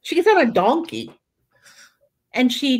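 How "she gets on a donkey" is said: much higher, amused retelling; high-pitched, amused disbelief; amused high repeat. Shown as a demonstration. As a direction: lift it high, tickled by the picture. Repeats donkey line after laughing.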